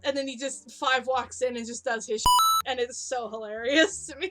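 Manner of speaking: normal talking voice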